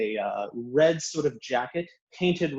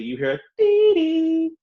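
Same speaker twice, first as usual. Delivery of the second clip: high electronic dee-dee chime; door chime; 8 dB louder than usual. Acted. GM sounding the shop door chime, high electronic beep. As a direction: chirp it like a sliding door's bell.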